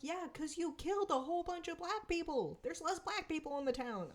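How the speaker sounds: high, biting sarcasm; sarcastic retort. Retold or imitated. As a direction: snap back with mocking disbelief.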